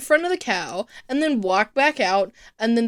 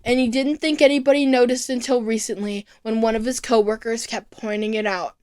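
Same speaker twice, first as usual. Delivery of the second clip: ordinary delivery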